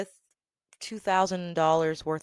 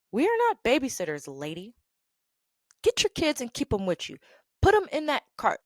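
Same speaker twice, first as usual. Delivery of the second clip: higher-pitched telling-off; mock scolding; 6 semitones higher than usual. Store worker telling off a mom who dumps her kids, scolding. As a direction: lay down the rule to a careless parent.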